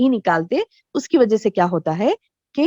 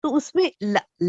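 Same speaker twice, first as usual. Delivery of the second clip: ordinary talk, nothing marked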